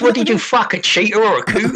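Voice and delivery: lightly voiced gobsmacked mate; mock film character. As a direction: hoot the line like the movie character. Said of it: "And he goes".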